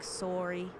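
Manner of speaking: "sorry" with a rounded Canadian "sore-ee" vowel. Shown as a demonstration. Streamer repeating the giveaway word, Canadian "sorry" as "sore-ee". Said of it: "biggest thing is when you hear".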